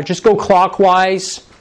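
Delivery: usual speaking voice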